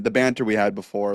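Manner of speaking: ordinary talk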